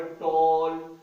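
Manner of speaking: टॉल drawn out loud as one long syllable; one unbroken syllable. Shown as a demonstration. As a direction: stretch टॉल in one piece, projecting it. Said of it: "इसको बोलने में हमें लफ्जों को तोड़ना नहीं पड़ा".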